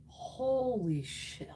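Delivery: slow, drawn-out awed exclamation; amazed. Real, not acted. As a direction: savor it in disbelief. Amazed again at product.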